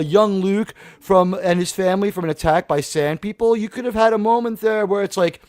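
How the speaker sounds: ordinary delivery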